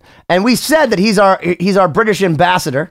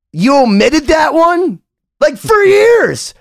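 high, drawn-out disbelief; incredulous; 10 semitones higher, slower than usual. Retold or imitated. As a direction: stretch it out high, utterly incredulous. Acted disbelief at friend's omission.

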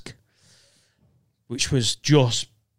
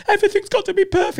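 squeaky frantic bride, much higher and fast; hammed-up high fussy wedding planner voice; mock uptight bride; 3 dB louder, faster than usual. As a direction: rattle it off like a stressed bridezilla. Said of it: "they're like".

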